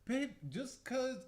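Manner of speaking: exasperated. Real, not acted. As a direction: defend it, exasperated. Defending car, pitch jumps high.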